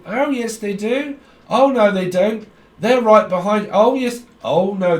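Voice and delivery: high, loud oh yes oh no back and forth; hammed-up pantomime bickering; panto argument. Psychic acts out spirits arguing, mock panto shouting.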